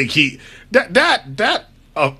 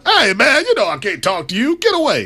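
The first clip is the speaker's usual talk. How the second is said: Magic Johnson impression; 7 semitones higher than usual. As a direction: push the Magic impression big and high. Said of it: "Do it one more time for me".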